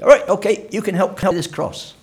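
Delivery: a soldier grabbing a passerby, ordering him; soldier's rough order. Retold or imitated. Preacher voicing Roman soldiers seizing Simon, loud rough order.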